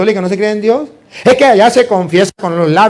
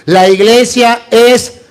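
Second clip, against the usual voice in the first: plenitud stretched syllable by syllable; slow, drawn-out; much slower than usual. Shown as a demonstration. Priest to congregation, drawing out each word slowly. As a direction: spell out "la iglesia es la plenitud" very slowly. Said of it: "Examinemos esa palabra, otra vez".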